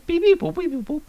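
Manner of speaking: high robot beeping; robot beeps. Pretend. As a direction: beep like a little droid. Imitating R2-D2 beeps.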